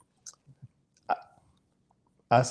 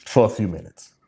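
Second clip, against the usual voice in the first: usual speaking voice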